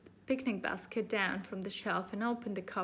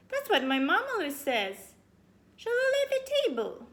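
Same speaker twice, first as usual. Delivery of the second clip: put-on high girl voice; 12 semitones higher, 6 dB louder than usual.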